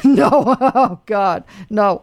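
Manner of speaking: real laugh breaking into the words. Real, not acted. Host reacts to goldfish as bait, laughing. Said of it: "I'm laughing because".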